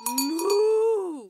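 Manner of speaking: high cartoon moo; cow moo. Molly Moo the cow mooing hello to everyone.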